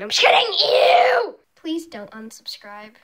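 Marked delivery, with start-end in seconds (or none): 0.2-1.3 high, loud disgusted shriek; grossed-out shriek. Real, not acted.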